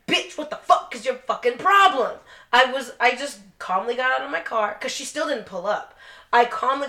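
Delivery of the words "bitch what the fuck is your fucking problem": mock angry yell. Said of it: "I wasn't like".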